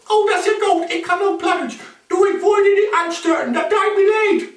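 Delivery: high, bright-pitched snake chatter; high-pitched snake apologising. Acted. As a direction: squeak it out as the little snake.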